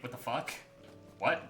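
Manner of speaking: ordinary delivery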